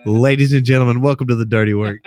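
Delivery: loud opening announcement; show announcer. Pretend. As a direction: belt out the show's welcome.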